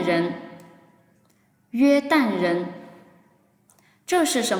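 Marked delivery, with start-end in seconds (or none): none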